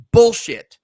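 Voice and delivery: loud blurted swear word; angry curse. Real, not acted. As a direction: spit it out in disgust. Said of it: "Excuse my language".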